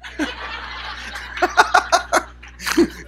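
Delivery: high, airy laugh. Real, not acted. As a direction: crack up at your own pun. Laughs at own joke.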